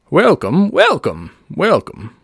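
high, drawn-out showman's welcome; stage voice. Acted. [name] practising his welcome before the show, showman's stage voice. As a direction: warm up a huckster's stage voice. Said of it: "let me get my stage voice on".

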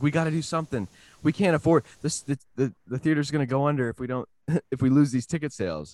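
high, urgent, fast pleading; panicked plea. Retold or imitated. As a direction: beg for help, panicking. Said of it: "They're like".

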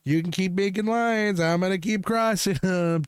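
high, slow teasing singsong; gleeful drawn-out defiance; playful taunt. Pretend. Playful taunt about crossing lines.

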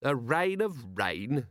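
slow, wondering question; drawn-out echo of disbelief; almost mesmerised. A narrator's reading. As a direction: repeat it slowly, half entranced. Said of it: "almost mesmerised by Visit's absolute conviction".

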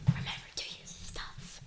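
soft unvoiced whisper. Real, not acted. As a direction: whisper it under your breath. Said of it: "Why are you whispering?".

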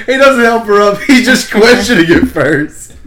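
talking through laughter; laughing. Real, not acted. Laughing at the grandpa story.

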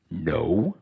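very low, booming-deep "No"; voice of the Lord. Pretend. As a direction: drop way down to answer as God. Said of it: "That was the Lord".

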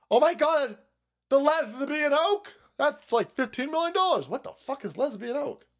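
high-pitched incredulous outburst; mock outraged disbelief. Taxpayer hearing the absurd window bill, mock outraged disbelief. Acted shock at the absurd repair item.

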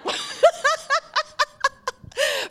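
long, high run of laughter; real laugh. Real, not acted. Preacher can't stop laughing at what was just seen, high laughter. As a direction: keep laughing helplessly, high-pitched. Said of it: "Forgive me for laughing".

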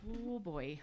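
usual speaking voice

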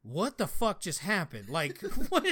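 laughing shocked what the fuck; stunned disbelief. Retold or imitated. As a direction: gasp it out in shocked amusement. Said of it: "and I'm like".

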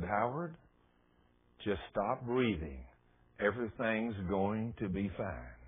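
soft, slow, calming reassurance; beautiful soothing voice. Retold or imitated. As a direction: imitate a gentle, lulling heavenly voice. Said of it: "the most beautiful voice I've ever heard".

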